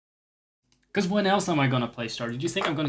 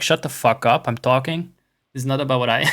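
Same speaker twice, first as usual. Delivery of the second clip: loud mock outburst; mock telling-off; 9 dB louder than usual. Streamer acting out snapping at Porkchop in chat, loud mock anger. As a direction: cut them off loudly, playing it up.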